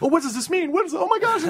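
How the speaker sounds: very high, frantic fan babble; imitated freak-out. Fans puzzling over a ten-second video, mock freak-out. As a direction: mimic fans losing it over a tiny clue. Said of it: "people were freaking out".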